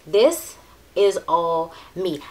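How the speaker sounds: slow, drawn out, leaning hard on "This"; emphatic repeat. Real, not acted. Beauty vlogger insisting the palette suits her, slow and emphatic. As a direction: stretch "This is all me" out slowly, stressing "This".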